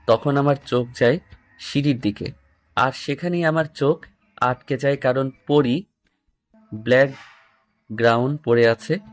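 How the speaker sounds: ordinary delivery, nothing marked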